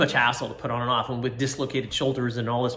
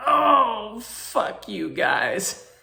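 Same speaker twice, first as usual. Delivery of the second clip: mock pained curse; 10 semitones higher, 4 dB louder, much slower than usual. Retelling reaction as wrist popped.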